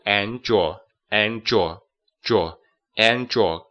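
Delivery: "and your" run together as one sound; linking and your. Shown as a demonstration. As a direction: blend "and your" smoothly, slow. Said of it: "And跟your要连读". (把"and your"连起来读)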